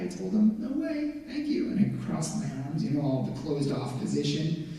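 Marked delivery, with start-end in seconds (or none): 0.6-1.7 high, soft brush-off; mock-polite no thanks, pitched up; dismissive refusal. Acting out his refusal to God.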